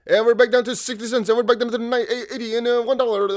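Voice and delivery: auctioneer. Pretend. Auctioneer-style price calling.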